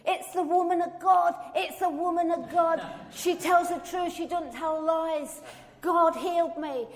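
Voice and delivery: high, loud joyful shouts in the street; retold shouting. Woman bursting from the chemist, shouting joyfully. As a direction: cry it out to everyone around. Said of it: "she's shouting".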